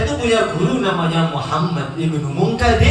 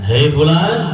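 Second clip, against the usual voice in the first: calling out to a patient; 5 semitones lower, 5 dB louder, slower than usual. Acted. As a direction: hail him, rising at the end. Tabib memanggil pasien stroke.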